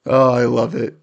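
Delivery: normal talking voice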